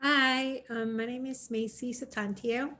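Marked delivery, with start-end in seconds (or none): none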